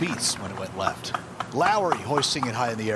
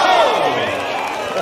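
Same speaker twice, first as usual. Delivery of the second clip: excited shout; 9 dB louder than usual. Real, not acted. Ace almost holed, excited call.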